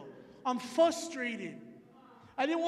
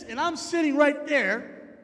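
usual speaking voice

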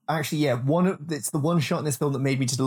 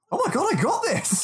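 much higher astonished realisation; much higher stunned delight; amazed; 13 semitones higher than usual. Retold or imitated. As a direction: gasp it in disbelief at your own strength. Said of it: "look at him going".

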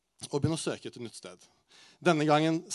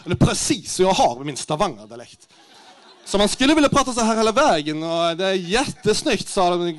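loud, higher Norwegian-Swedish mix with rolled R; hammed-up Scanian accent, talking big; mock Skåne Swedish; 4 semitones higher, 9 dB louder than usual. Stand-up telling crowd how he spoke at work, hammed-up Skåne accent. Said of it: "Så jeg bør gjøre prata skånska".

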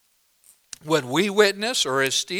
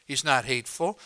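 ordinary talk

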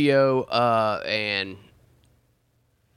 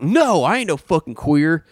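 higher-pitched indignant imitation; venomous denial; 4 semitones higher than usual. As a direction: deny it with mock outrage. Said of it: "he says it with this venom".

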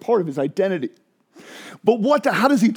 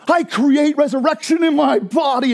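proclaiming shout; 7 semitones higher than usual. Acted. Preacher shouting Jesus's claim to the congregation in triumph.

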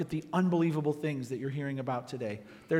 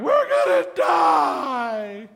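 very slow, loud, higher shout; panicked cry; 9 semitones higher, 8 dB louder, much slower than usual. Retold or imitated. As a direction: wail it in terror. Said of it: "they're crying out".

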